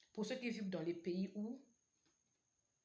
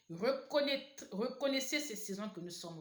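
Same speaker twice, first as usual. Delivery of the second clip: usual speaking voice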